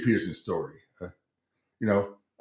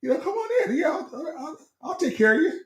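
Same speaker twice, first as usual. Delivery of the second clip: hammed-up squeaky-high eager welcome; high-pitched voice. Club worker eagerly welcoming a star athlete inside, high-pitched voice. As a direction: gush in a very high falsetto. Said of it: "I must have my high-pitched voice going".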